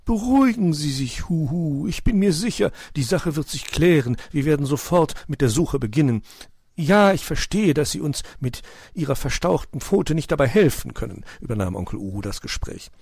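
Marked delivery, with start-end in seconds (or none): 0.0-11.2 lightly voiced owl, calming the mother; reassuring owl. Onkel Uhu beruhigt Weißpfote am Telefon, sanfte Eulenstimme. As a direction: soothe the worried mother on the phone.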